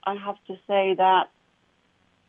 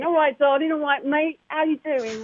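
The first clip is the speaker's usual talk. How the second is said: higher-pitched, chummy Cockney; playful Cockney, pitched up; Cockney accent; 7 semitones higher than usual. Shown as a demonstration. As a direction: greet a mate in broad Cockney. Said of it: "the Cockney accent".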